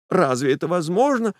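high, suddenly hopeful; Nastenka's high voice; hopeful young woman. A narrator's reading. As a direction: lift her head with hope.

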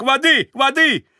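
high, loud cry for water; frightened cry. A narrator's reading. Varvara cries out in fright for water. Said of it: "Раздался её испуганный крик".

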